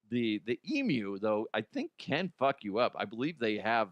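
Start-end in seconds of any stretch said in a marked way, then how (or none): none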